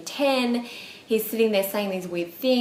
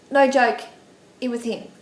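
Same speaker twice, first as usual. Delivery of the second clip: ordinary talk, nothing marked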